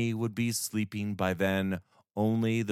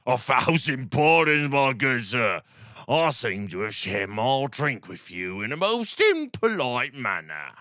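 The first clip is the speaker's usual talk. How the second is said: put-on slurred, higher drunken reveler; town drunk; 3 semitones higher, 4 dB louder than usual. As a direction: slur it like a merry drunk. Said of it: "The reveler slurred his words".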